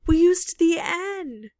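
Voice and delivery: high, loud, slow outcry; yelled dismay. Real, not acted. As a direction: cry out over the blunder, drawing it out. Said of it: "I yelled at you people".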